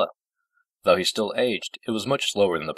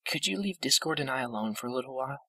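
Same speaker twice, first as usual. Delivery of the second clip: high, softer, frail voice; gentle old pony, asking; 9 semitones higher, 5 dB quieter than usual. A narrator's reading. Fluttershy asking the princess for privacy, high and soft. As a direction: ask a favour softly up high.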